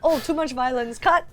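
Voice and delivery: mock censor. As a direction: act a censor stopping the scene, joking. Plays censor cutting the scene.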